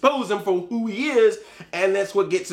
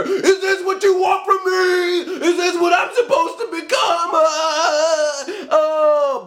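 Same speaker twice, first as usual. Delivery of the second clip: much higher, louder, slow hammed-up anguished wail; melodramatic crying shout; 9 semitones higher, 4 dB louder, slower than usual. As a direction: overplay Magneto's grief to mock it. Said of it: "made it a little melodramatic with freaking Michael Fassbender".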